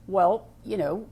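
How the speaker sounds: usual speaking voice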